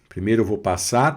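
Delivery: ordinary delivery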